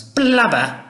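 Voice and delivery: a high, loud mocking jab; Mother's cruel word. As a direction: spit it out like a spiteful mother. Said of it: "Mother rather cruelly started calling it flab".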